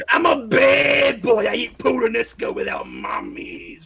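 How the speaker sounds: slow, whiny bad-boy voice; hammed-up sulky tough guy; mock bad-boy whine. Player mocks her evil wizard, slow and whiny.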